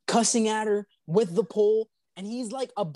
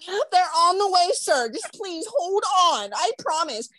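high-pitched put-on dispatcher voice; imitated pleading operator; 12 semitones higher than usual. 911 operator to impatient caller, mock pleading.

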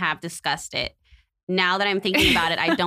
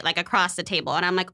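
ordinary talk, nothing marked

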